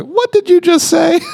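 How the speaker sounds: high-pitched mock affront; religious indignation. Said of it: "a little bit of the religious spirit".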